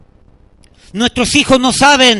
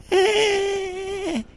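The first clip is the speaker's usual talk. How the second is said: put-on high, soft, drawn-out baby wail; imitated baby cry; 9 semitones higher, 12 dB quieter than usual. Preacher imitating a little child crying, high thin wail.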